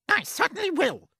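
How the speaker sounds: high, sharp snap; snapped. Acted. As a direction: snap back at her sister. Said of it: "Aunt Spiker snapped".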